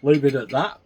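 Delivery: talking through a mouthful; chewing while talking; mouth full. Real, not acted. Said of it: "Excuse me talking with my mouth full".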